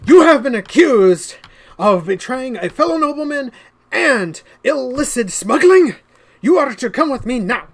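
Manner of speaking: shouted, high, formal accusation; loud commanding guard. Acted. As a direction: bellow the charges at him. Guard announces arrest.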